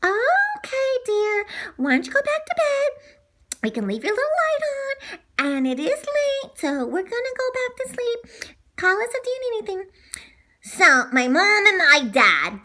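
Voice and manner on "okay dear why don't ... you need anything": tuck him back in gently; soothing mom. Acted. Mom sending her son back to bed, high soothing voice.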